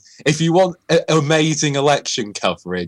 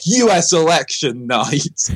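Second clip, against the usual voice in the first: announcer tagline; 3 semitones higher, slower than usual. Retold or imitated. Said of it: "it was like".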